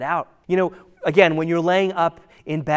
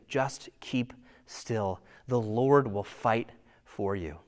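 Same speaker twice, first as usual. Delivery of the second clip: soft and slow, barely above a murmur; a hushed, slow echo of the promise; hushed assurance; 6 semitones lower, 6 dB quieter, slower than usual. Retold or imitated. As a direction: let the promise settle quietly. Repeating Moses' promise, hushed.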